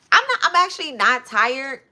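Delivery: loud, high-pitched protest; insistent protest. Real, not acted. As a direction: push back hard on being sent to bed. Protesting after being told to rest.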